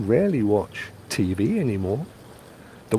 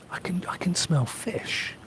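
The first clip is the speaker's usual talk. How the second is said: soft whisper with higher pitch; 8 semitones higher, 8 dB quieter than usual. Real, not acted. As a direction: whisper it as if noticing a smell. Noticing fish cooking nearby.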